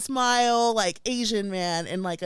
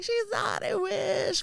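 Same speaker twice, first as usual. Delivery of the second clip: high wistful reply; 13 semitones higher, 3 dB quieter, slower than usual. Retold or imitated. Narrator answers fans.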